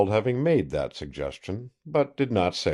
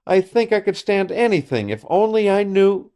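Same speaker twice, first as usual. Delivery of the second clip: high, loud, near-tearful plea; desperate and close to breaking; on the edge of sobbing; 12 semitones higher, 6 dB louder than usual. Acted. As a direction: fight back sobs as you speak. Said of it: "the mutinous sobs came crowding past her lips".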